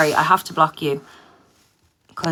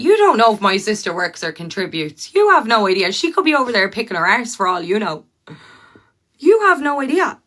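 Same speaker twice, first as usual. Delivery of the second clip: loud, raised-pitch heated comeback; indignant retort; 5 semitones higher, 7 dB louder than usual. Real, not acted. Streamer firing back at a commenter about their sister, indignant. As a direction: argue back hard, voice jumping up.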